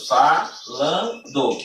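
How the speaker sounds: "falando" very slow, split fa-lan-do; syllable by syllable. Shown as a demonstration. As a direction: break "falando" into three separate beats. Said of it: "três sílabas".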